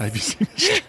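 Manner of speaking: high playful pun. Pretend. Pun on Cena Street.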